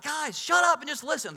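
high, loud call for silence; coach yelling. Retold or imitated. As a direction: coach repeating the yell at his team, loud and high. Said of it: "I was like".

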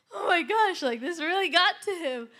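high, loud exclamation through laughter; amused astonishment. Retold or imitated. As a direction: laughingly act out being amazed by the confession. Amused at boy confessing again.

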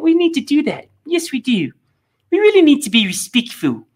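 high, patronizing New Zealand accent impression; sing-song New Zealand accent, talking down; mock New Zealand accent. Commentator mocking the New Zealand leader in a New Zealand accent. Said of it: "that is a great New Zealand accent".

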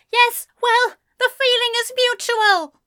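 high, loud retort; shot back. A narrator's reading. Ezra shooting back at departing Anthony, high and loud. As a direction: fire it back at him. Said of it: "Ezra shot back".

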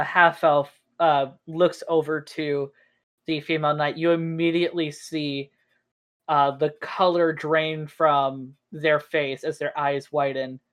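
usual speaking voice

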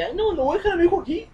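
imitación de mamá angustiada, mucho más aguda y rápida; mamá preocupada. Retold or imitated. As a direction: chillido rápido de madre nerviosa. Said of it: "las mamás bien preocupadas". (mamá que no quiere dejar a su hijo, aguda y apresurada)

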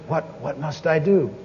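soft, low imitation of gasping; half-gasp it, quietly imitating a stunned man; mildly gaspy. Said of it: "he said it more gaspy than that".

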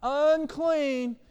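high, loud drawn-out cry; leper's warning call. Retold or imitated. Lepers had to walk around warning people, loud high call. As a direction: call it out far and wide, stretched. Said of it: "had to walk around going".

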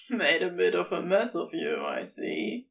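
low, quick amused tease; amused serpent lover. Acted. Crowley teasing the mess on Aziraphale, low and amused. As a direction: tease him through leftover laughter.

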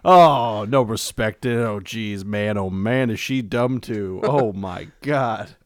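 put-on loud, exasperated old comic; Rodney Dangerfield impression. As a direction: gripe like a nervous-hammy comedian. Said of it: "Rodney Dangerfield".

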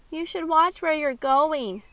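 loud, slow call after them. Acted. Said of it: "Kylie called out after them".

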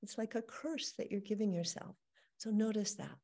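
usual speaking voice

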